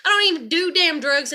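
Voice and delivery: ordinary talk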